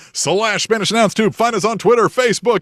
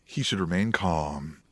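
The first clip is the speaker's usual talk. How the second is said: mock calm; 11 dB quieter than usual. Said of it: "Find your calm".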